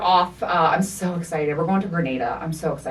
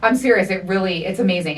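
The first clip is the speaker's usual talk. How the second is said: ordinary delivery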